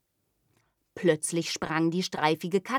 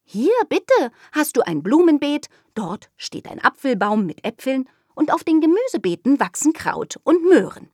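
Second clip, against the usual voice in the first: put-on louder, higher proud child; eager little girl; 8 semitones higher, 6 dB louder than usual. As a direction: show off the garden with glee. Girl proudly lists garden.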